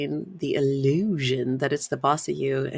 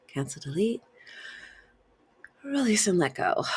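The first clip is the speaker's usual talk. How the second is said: whispering; 5 semitones higher than usual. Shown as a demonstration. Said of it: "The first one is simply".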